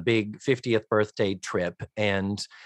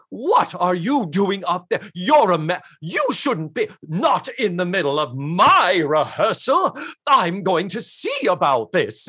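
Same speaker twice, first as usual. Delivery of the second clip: indignant choir director; 6 semitones higher, 3 dB louder than usual. Acted. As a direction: play a pompous, affronted choir director. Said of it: "the choir director with awful teeth".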